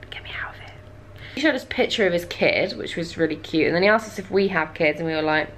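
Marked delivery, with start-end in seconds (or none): 0.1-0.7 desperate aside under the breath; barely audible, voiceless plea; whispered plea. Retold or imitated. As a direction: whisper it as a secret inner thought. Inner plea during awkward talk.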